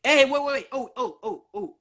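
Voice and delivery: sudden laugh, then fast words; laughing interruption. Real, not acted. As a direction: cut in laughing and rush on.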